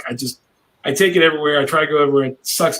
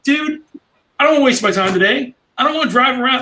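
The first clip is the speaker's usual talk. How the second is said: higher, exasperated protest; fed-up complaint; 10 semitones higher than usual. Retold or imitated. To the seller, pitched-up fed-up protest. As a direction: whine that the day is being wasted.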